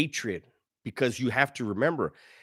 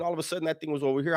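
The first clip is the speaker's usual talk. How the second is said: ordinary talk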